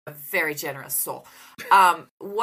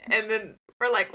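ordinary delivery

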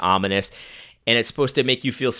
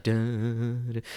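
mock ominous music; 8 dB quieter than usual. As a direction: hum the ominous movie sting softly. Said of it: "there's scary music playing".

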